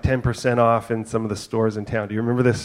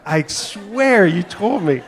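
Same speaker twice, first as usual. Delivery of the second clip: much higher, slow, pleading to God; exasperated plea; 8 semitones higher, 4 dB louder, slower than usual. Retold or imitated. Dad on the empty court pleading with God, exasperated. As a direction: draw it out in disbelief.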